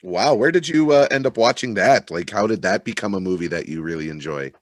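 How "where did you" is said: usual speaking voice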